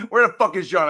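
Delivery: loud yell at the missing friend; angry yell. Real, not acted. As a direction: yell it out, really pissed off. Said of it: "I need to yell at somebody".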